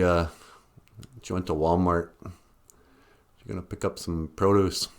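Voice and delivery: ordinary delivery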